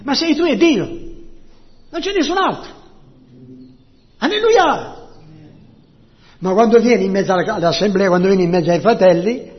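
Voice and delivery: loud, impassioned preacher; forceful preaching. Real, not acted. Preacher on testimony among the brethren, forcefully.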